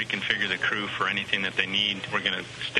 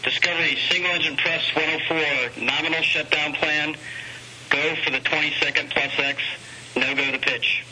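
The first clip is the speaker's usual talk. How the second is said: raised, higher voice for the crew; loud radio call; 5 semitones higher, 4 dB louder than usual. Real, not acted. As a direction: project it over the radio link. Calling the shuttle crew by radio.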